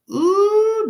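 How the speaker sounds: a high, loud, squealing ooh; high shocked ooh. Real, not acted. Hearing the slap comment, a high loud squealed ooh.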